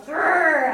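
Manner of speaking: loud mock growl at a girl; a playful, loud grrr; scary growl. A narrator's reading. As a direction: growl like a cross little kid. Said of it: "I made a scary face at that girl".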